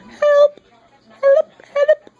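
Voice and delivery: high, loud playful shouts of help; mock cry for help. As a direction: yell help like a cartoon damsel. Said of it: "I'm getting slap happy".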